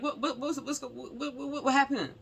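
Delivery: high, stuttering retold question; alarmed stammer. Parent on the phone demanding news of the son, alarmed stammer.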